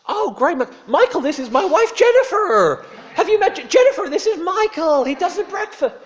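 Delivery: very high, loud, gushing American voice; booming cheery tourist greeting the waiter. Retold or imitated. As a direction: ham up an overfriendly tourist. Tourist introduces wife.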